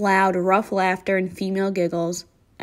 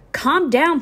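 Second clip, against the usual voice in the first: sharp, raised "calm down"; urgent call; 8 semitones higher, 4 dB louder than usual. Acted. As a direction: snap him out of his panic. Johnny steadies panicking friend.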